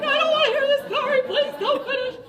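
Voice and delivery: high, loud mock plea of horrified moms. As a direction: squeal it like the moms begging her to stop. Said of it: "Look at all the moms in the room like".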